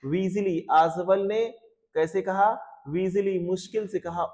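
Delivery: ordinary talk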